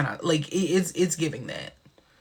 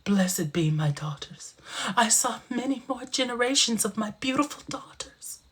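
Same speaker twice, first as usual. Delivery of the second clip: whispered blessing; 6 semitones higher than usual. Acted. Voices grandma blessing her daughters.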